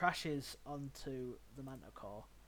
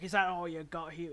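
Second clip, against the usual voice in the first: loud, raised taunt; cocky manticore; 4 semitones higher, 10 dB louder than usual. Acted. Manticore pipes up against the hammer wielder, loud taunt. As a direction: goad the attacker, louder and higher. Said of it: "pipe up against the Manticore's like".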